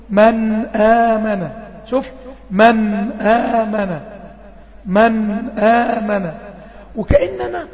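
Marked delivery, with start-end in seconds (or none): 0.1-1.5 slow drawn-out recitation of "من آمن"; Quran recitation demo. Shown as a demonstration. Teacher reciting "من آمن" for students, slow and drawn out. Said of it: "لما أقول". 2.5-6.3 "من آمن" very slow, pausing a touch on the nun; slight hold on nun. Shown as a demonstration. Teacher showing the pause on the nun in "من آمن". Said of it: "وقفت على النون سنة بسيطة".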